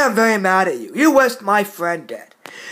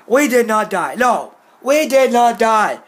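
usual speaking voice

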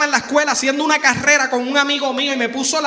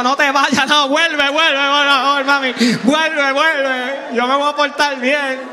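loud desperate begging; pleading child; 2 semitones higher, 7 dB louder, slower than usual. Retold or imitated. Child begging mother not to leave, loud pleading. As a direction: wail it like a kid clinging to mom.

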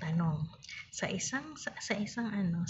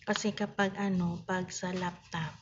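ordinary talk, nothing marked

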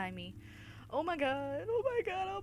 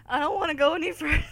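scared; 5 semitones higher, 9 dB louder, much faster than usual. Real, not acted. Scared, loud and fast.

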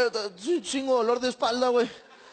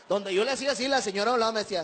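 ordinary delivery

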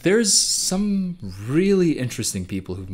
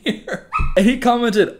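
normal talking voice

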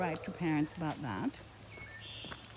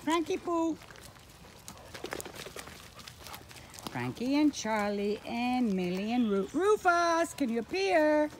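calling out; 8 semitones higher than usual. Real, not acted. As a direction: call the dogs from afar. Calling dogs on a walk.

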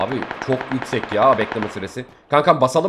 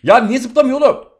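high, loud exasperated cry; loud annoyed shout at the character; frustrated yell; 13 semitones higher, 10 dB louder than usual. Real, not acted.

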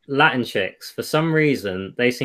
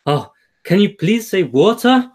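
put-on high, louder voice of gushing girls; imitated admiring girls; 8 semitones higher, 5 dB louder than usual. Girls from Argentina begging to hear "water", imitated in a high voice. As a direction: imitate girls thrilled by an accent. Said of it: "and they were like".

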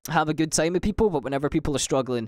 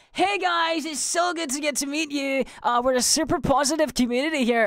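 high, saccharine mock host voice; mocking fake-positive streamer; 12 semitones higher than usual. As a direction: parody an oblivious upbeat streamer. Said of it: "And this guy was like".

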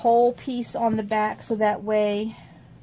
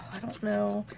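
whisper; 7 dB quieter than usual. Real, not acted. Musing to herself while crafting.

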